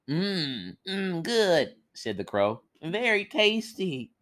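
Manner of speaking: lightly voiced crow, relishing; smacking crow. Crow gobbles the eyeballs, smacking with relish. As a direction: savour it like a greedy bird.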